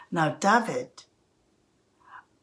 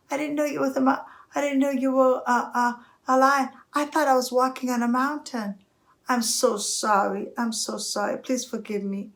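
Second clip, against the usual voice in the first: higher-pitched, stammering little mouse; pleading mouse; 6 semitones higher than usual. Acted. Mouse begging the lion for forgiveness, pleading. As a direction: beg for mercy, tripping over words.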